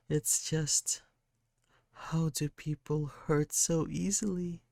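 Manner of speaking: put-on a hurt kid apologising, voice lifted; sad kid. Sad kid character speaking.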